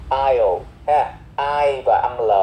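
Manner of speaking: normal talking voice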